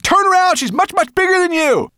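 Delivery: high-pitched shouted warning; urgent shout. A narrator's reading. Ben warning Firedrake about the bigger bird, urgent shout. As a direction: yell a frantic warning up high. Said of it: "Ben shouted to Firedrake".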